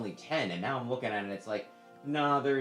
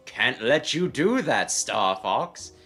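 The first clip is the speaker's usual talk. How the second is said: slow, louder gruff Star Fox wolf; 8 dB louder, slower than usual. Retold or imitated. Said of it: "give him the wolf voice".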